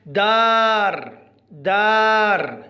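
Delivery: دار very slow, the aa held long; long aa demo. Shown as a demonstration. Teacher showing pupils how to pronounce, دار slowly drawn out. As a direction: stretch the vowel in دار, each time. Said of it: "لاحظوا معي كيف نلفظ".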